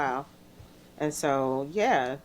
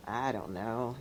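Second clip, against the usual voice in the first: a small, softer, lower shy reply; shy mumble; 6 semitones lower, 9 dB quieter than usual. Retold or imitated. Young DJ answering friends' praise, shy and soft. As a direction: shrink away from the praise, murmuring. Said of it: "so I was being really shy".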